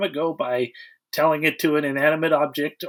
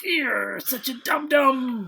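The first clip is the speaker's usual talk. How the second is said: mock scolding; 6 semitones higher, much slower than usual. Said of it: "And she's all like".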